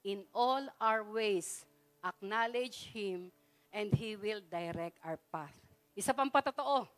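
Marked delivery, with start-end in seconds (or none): 0.0-5.5 slow, lower, soft verse reading; slow, quiet Bible verse; slow scripture recitation. Acted. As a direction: recite each word slowly and solemnly. Reciting verse slowly again.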